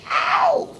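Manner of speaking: very high sung whoop; a sharp falsetto ow; funky yelp. Acted.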